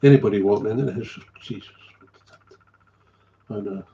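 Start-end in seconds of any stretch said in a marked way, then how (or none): 1.4-2.6 hushed, breathless disgust at the smell; an appalled guest muttering under their breath; shocked whisper. Retold or imitated. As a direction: whisper it like a visitor recoiling from the stench. Visitor recoils at the stinking house.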